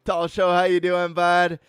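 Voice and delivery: loud greetings. Real, not acted. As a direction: call out each name like a host. Greeting chatters one by one.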